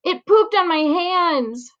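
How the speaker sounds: slow, high, drawn-out moan. Acted. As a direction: moan it in misery. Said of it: "Frankie moans".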